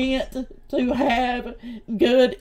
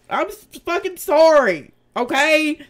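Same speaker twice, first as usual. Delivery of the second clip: loud, exasperated "fucking sorry"; irritated, sarcastic sorry to the chat; angry apology; 3 semitones higher, 7 dB louder than usual. Real, not acted. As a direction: throw the apology out in frustration.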